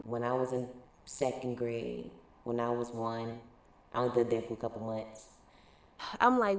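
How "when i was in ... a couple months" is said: much lower, softer mock voice; mocking transplants. Mocking transplants who claim up north. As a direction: drop low to mock their excuse. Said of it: "most times people will say".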